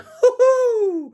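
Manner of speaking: loud, very high-pitched whoop. Real, not acted. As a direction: let out a falsetto "woo-hoo". Whoops over his risky pick.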